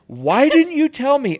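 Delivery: high, loud, drawn-out mock indignation; retold mad outburst. Husband retells confronting his giggling wife, mad and loud. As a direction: re-enact an exasperated yelp of complaint. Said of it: "which made me so mad".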